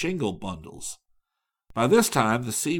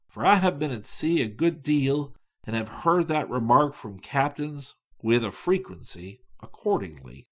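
ordinary delivery

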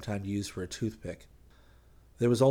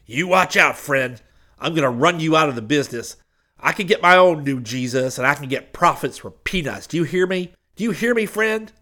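loud threat climbing much higher; hoarse, impassioned threat; 6 semitones higher, 10 dB louder than usual. A narrator's reading. Preacher threatening Hayes through the car's tin, hoarse and impassioned. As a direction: threaten hard, rising to a high cry. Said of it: "the hoarse voice said".

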